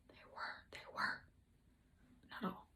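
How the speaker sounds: unvoiced conspiratorial whisper; whispered aside. Vlogger confides to viewers in a whisper. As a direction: lean in and whisper it like a secret.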